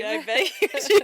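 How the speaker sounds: loud, fast, high teasing quip; sarcastic teasing. Real, not acted. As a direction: needle her sarcastically. Teasing sister about nickname.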